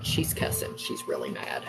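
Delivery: imitated conspiratorial whisper; very low and soft, barely voiced; hushed warning. Son reporting mom's cussing to his sister, whispered.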